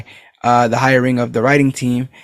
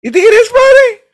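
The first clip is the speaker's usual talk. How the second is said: surprised question; 6 dB louder than usual. Real, not acted. Surprised guess about the name.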